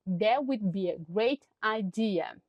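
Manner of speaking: usual speaking voice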